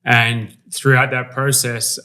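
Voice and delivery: normal talking voice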